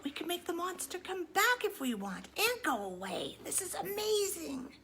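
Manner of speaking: usual speaking voice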